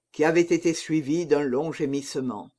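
usual speaking voice

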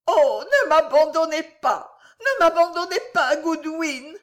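high, loud hysterical wail; cling desperately to the maid, voice way up; convulsive pleading. A narrator's reading. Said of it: "murmura madame Pott en s'arrachant avec une force convulsive". (supplication hystérique et suraiguë)

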